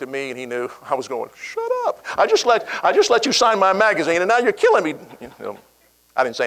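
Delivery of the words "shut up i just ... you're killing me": mock scolding. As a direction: tease him like he's ruining the fun. Ali mock-scolds manager, laughing.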